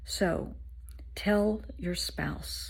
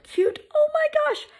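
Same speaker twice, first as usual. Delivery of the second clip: gushing; 5 dB louder than usual. Real, not acted. Squealing to the listeners about a cute little boy.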